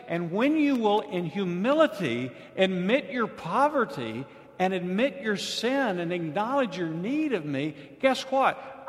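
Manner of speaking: high, loud preaching build; full-force sermon climax; fiery preaching. Acted. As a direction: drive it home to the congregation.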